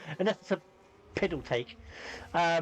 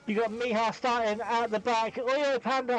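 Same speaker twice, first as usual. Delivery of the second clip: ordinary talk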